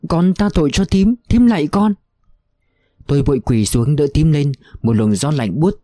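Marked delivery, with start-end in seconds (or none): 0.1-1.9 kneeling and pleading, more and more desperate; moaning plea. A narrator's reading. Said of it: "rên rỉ". (van xin rên rỉ, ngày càng cao giọng)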